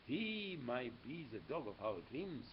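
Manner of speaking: usual speaking voice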